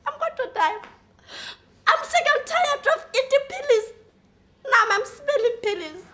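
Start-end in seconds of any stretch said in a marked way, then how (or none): none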